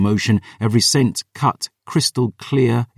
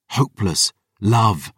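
usual speaking voice

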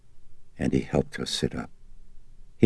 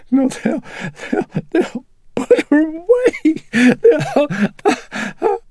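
high, loud broken wail; sobbing; 9 dB louder, much slower than usual. A narrator's reading. Mother fearing for her daughter, sobbing uncontrollably. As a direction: sob the words out, stumbling over them. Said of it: "Chris sobbing".

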